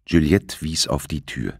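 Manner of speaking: ordinary delivery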